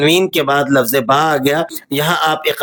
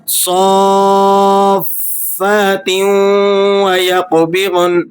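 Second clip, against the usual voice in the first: صَافَّاتٍ stretched very slowly; slow recitation; 5 semitones higher, much slower than usual. Shown as a demonstration. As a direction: draw out صَافَّاتٍ, then وَيَقْبِضْنَ. Recited demo word. (آہستہ کھینچ کر تلاوت)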